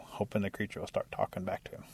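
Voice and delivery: plain reading voice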